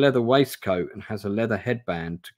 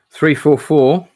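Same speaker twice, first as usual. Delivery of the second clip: page number called out loud and high; excited callout; 5 semitones higher, 11 dB louder, slower than usual. Real, not acted. Streamer calling out a page number, loud and eager.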